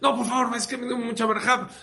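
high-pitched, upset pleading, lightly acted; insistencia alterada imitada. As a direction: insist as if distressed at being refused. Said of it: "se puso mal". (súplica alterada e insistente, imitada)

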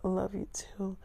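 high whispered I love you too; whispered girlfriend. Retold or imitated. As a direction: breathe it as a tender whisper. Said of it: "It's like".

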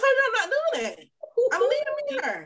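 high-pitched retold protest; acted refusal. Wife retelling how she turned him down, high-pitched refusal.

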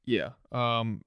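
ordinary delivery

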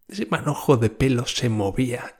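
usual speaking voice

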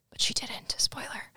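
a hushed, unvoiced spoiler whisper; whispered aside. Real, not acted. Host sneaking a spoiler to the listeners, whispered. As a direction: lean in and whisper the secret.

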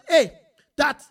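ordinary delivery

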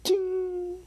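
very high ringing "ding"; ping it way up high; imitated ding. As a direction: sing out a tiny metallic chime. Imitated noise of cuffs.

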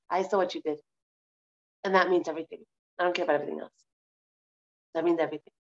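low, softened voice of reassurance; forgiving God. Acted. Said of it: "I want him to go to the side of".

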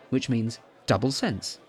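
ordinary delivery

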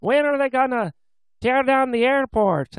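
high-pitched mock Newfoundland locals; Newfoundland accent. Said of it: "my bad representation of a Newfoundland accent".

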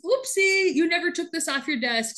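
high-pitched mock-innocent oopsie. Intern showing the boss her misplaced memo, mock innocent.

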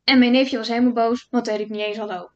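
plain reading voice

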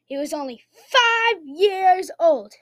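dramatic storybook narration, drawn out; storyteller. Pretend. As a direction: swing the pitch and punch key words. Tells an improvised story.

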